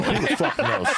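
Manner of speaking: high-pitched mimicking voice with laughter; Mitzi's voice. Mocking how the comic imitates Paul, in a high impression voice. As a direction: parody it up high, on the edge of laughing. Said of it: "when you do the impression".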